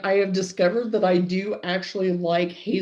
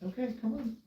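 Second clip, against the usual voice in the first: distant coaxing voice, far off; 5 semitones higher, 24 dB quieter, faster than usual. Real, not acted. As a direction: coax the dog from across the yard.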